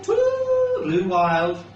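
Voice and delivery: plain reading voice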